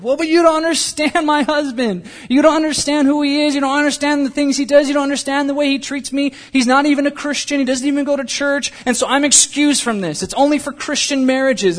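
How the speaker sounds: high, insistent string of complaints; protesting wife. Acted. Acted objection of a wife.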